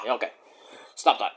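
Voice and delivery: ordinary talk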